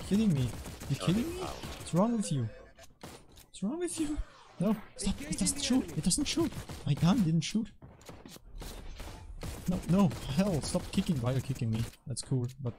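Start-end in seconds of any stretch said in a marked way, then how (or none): 0.1-7.7 high-pitched, incredulous protest; frustrated gamer, pitch shooting way up; exasperated outburst. Real, not acted. Gun fails during a tense fight. 9.7-11.2 high, urgent objection; exasperated gamer, voice jumping up; agitated protest. Real, not acted. Gamer getting kicked in play.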